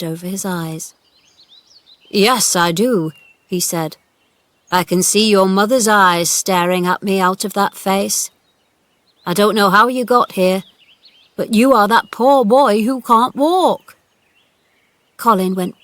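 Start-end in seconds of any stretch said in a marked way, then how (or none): 2.1-3.1 put-on slow, loud old-man reply; gruff old gardener. A narrator's reading. Gardener answering the boy, gruff and grave. 4.7-14.0 put-on gruff old gardener. A narrator's reading. Gardener answering the boy, gruff and grave. Ben talks to Colin.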